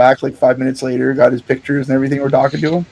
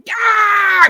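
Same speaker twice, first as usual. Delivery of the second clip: imitated wrestler yell. Wrestler Sebi hyping the crowd on entrance, wild high yell. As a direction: belt out "Yeah!" like a hyped wrestler entering. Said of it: "Weird fucking sounds".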